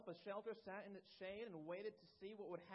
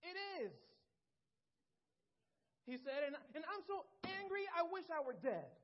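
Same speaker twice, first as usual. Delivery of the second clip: higher and louder, furious outburst; angry Jonah; 7 semitones higher, 6 dB louder than usual. Acted. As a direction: spit it out like a sulking angry prophet. Jonah's angry reply voiced.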